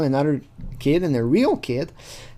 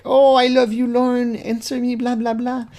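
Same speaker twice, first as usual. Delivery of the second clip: put-on higher, louder lovey-dovey girl voice; mock girly gush; 9 semitones higher, 3 dB louder than usual. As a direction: gush like an overeager girlfriend. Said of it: "She's being like".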